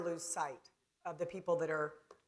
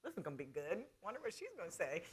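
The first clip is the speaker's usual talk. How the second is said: wry inner thought; 11 dB quieter, faster than usual. Retold or imitated. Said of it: "and I'm like".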